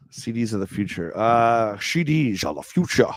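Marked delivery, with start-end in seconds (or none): 1.8-3.1 put-on slurry Scottish Sean Connery accent, s turned to sh; "C Ds" said as "shee deezh", Sean Connery style; Sean Connery impression. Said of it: "You need to do that in a Sean Connery accent".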